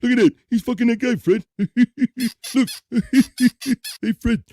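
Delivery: high, loud giggling cartoon voice; cartoon sidekick. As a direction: cackle and call to your pal in bursts. Cartoon sidekick goofing with Fred.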